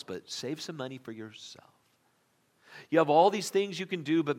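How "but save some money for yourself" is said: whisper. Retold or imitated. Mocking the ad emails.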